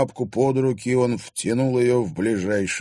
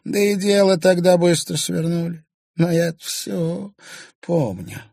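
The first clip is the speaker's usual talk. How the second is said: high, louder crone, slowing; brooding old crone; 10 semitones higher, 4 dB louder than usual. A narrator's reading. Old woman recalls the case to the journalist, high and brooding. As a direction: remember it darkly.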